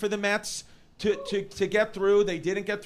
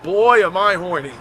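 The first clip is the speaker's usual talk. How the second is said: mock horny; 2 semitones lower, 11 dB louder than usual. Comic confession on mic.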